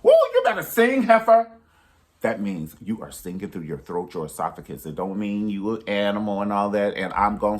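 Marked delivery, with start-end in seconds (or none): 0.0-1.5 high, loud diva holler of praise; shouting woo from the front pew. Retold or imitated. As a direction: belt it out like a gospel diva cheering. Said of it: "even [name] be like".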